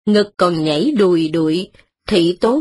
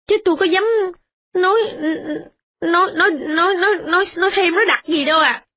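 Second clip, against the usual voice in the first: a touch of fearful stammering, higher; frightened servant; 6 semitones higher than usual. A narrator's reading. Tenant woman nervously confessing to the stern mistress. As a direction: stammer it out, afraid. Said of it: "nghe bà hâm thì sợ".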